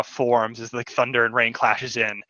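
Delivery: ordinary talk, nothing marked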